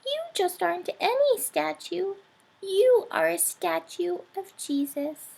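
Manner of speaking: little mouse realizing something; sighing mouse. A narrator's reading. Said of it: "sighed Mortimer".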